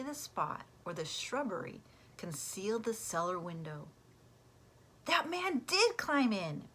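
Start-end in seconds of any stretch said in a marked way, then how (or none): 5.0-6.7 high, slow, excited cry of discovery. Acted. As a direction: cry it out like you just proved it. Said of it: "Pete cried".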